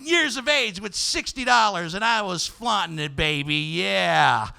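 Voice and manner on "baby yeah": ordinary talk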